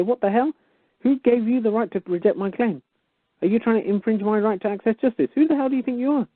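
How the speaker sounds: high, outraged challenge; indignant demand. Retold or imitated. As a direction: demand answers, pitch climbing high. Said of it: "You write back and you say".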